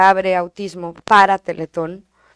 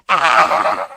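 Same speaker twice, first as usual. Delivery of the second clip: put-on child's wordless yell, loud and strained; grito infantil; 9 semitones lower, 5 dB louder than usual. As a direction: imitate a child's frustrated scream. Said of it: "Eran unos gritos". (grito de niño frustrado)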